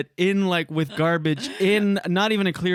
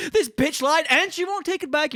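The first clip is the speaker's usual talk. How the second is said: higher, mock-indignant complaining; mock outraged rant; 11 semitones higher than usual. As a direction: play the buyer who feels cheated, whining. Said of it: "you're gonna go".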